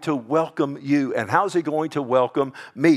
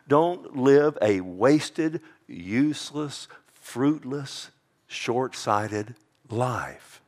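slow, weighted list; much slower than usual. Real, not acted. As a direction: drag out each word slowly. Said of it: "preacher slows each word for weight".

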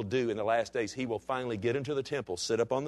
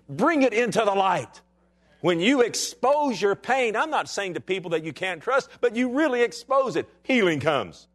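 higher, louder preacher's cry; full-force preaching; 8 semitones higher, 5 dB louder than usual. Acted. Pastor exhorting his congregation, in full-force preaching. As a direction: preach it out to the church, rising.

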